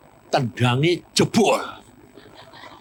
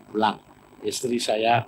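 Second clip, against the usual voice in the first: ordinary delivery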